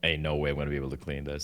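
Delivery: soft, fast resigned mutter; muttering to myself. Retold or imitated. Alone upstairs with the ruined shirt, soft fast mutter. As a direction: rush it under your breath. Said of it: "I'm like".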